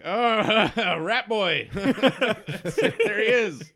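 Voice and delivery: loud, high, laughing welcome; boisterous tavern toast; cheering locals. Acted. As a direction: hail a friend with a laugh. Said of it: "they raise their glasses to you".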